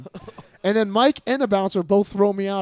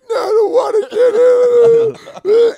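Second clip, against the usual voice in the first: much higher, louder, slow bawling No; crying wail; 12 dB louder, much slower than usual. Retold or imitated. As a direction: wail like a tantrum child. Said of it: "I'm like".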